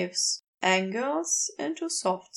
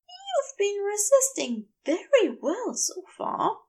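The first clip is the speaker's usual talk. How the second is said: lightly higher, gentle angel voice; Aziraphale voice; 10 semitones higher than usual. A narrator's reading. Aziraphale teasing.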